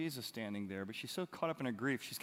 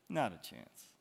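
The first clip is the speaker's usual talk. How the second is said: ordinary delivery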